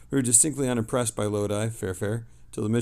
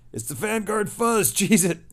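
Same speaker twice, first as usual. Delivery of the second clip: higher, slower, hammed-up crook, laughing into "cheese it"; mock crook fleeing; 10 semitones higher, 7 dB louder than usual. Streamer joking with chat about police sirens, as a mock crook. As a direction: play an old-movie crook spotting the cops. Joking cop-chase panic.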